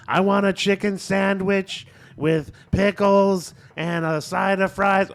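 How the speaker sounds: slow, high, loud ordering holler; drive-through yelling. Retold or imitated. Friend yelling his order at a drive-through speaker, slow mock yell. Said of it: "yelling at the thing".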